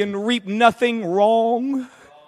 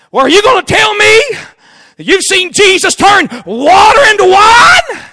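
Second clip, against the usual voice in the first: high, loud scornful shout; shouted mocking challenge; skeptic shouting; 10 semitones higher, 13 dB louder than usual. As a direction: yell the challenge in disbelief.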